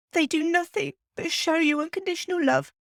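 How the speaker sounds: grieving, barely holding it together; emotional. Real, not acted. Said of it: "I've got emotional".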